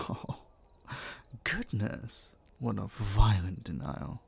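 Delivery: slow, drawn-out playful exaggeration; teasing mock surprise. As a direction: savour each word as he teases her. Teasing the listener's denial.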